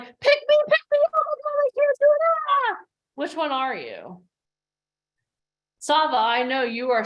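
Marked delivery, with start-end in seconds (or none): 0.0-2.8 high, loud squealing "pick me"; hammed-up frantic squeal, much higher and louder; frantic pleading. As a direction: beg to be chosen in a frantic squeal. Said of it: "Or you're like".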